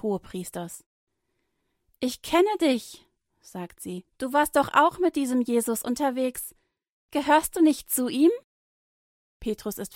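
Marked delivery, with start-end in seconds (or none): 2.0-3.1 high, loud and slow, pointing him out; a maid calling him out slowly; accusing maid. A narrator's reading. Maid confronting Petrus, loud and slow. 4.2-8.3 loud, rising challenge; accusing question. A narrator's reading. Maid accusing Petrus in the courtyard, loud.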